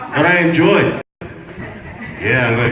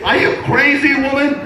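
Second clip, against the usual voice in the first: high, punchy repeat; Are you crazy, woman shouted high and sharp; mock yell; 12 semitones higher than usual. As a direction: hurl it at her, pitched way up. Husband yelling at his wife.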